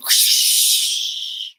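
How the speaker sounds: loud breathy hiss; dragon hiss. Acted. Dragon answers the little girl with a hiss.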